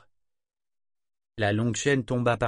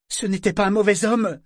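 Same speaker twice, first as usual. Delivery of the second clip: high, loud blurted protest; exclaiming; 11 semitones higher, 7 dB louder than usual. Acted. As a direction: blurt it out, much higher. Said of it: "s'exclama-t-il brusquement". (exclamation brusque et aiguë)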